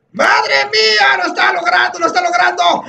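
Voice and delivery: high, urgent play-by-play cry; excited shouting. Real, not acted. Gamer cheering on a parkour run, excited shouting. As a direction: call it out as it nearly happens. Commentating his own near success.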